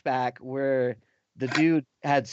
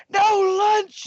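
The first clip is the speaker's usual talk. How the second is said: put-on outraged girl; 6 dB louder than usual. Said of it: "she's like".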